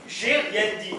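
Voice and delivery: fed up, throw it at her; exasperated denial. Acted.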